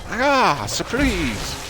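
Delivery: high mock villain gloat; gloating surprise, hammed up; sneering panto villain. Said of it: "and then he's like".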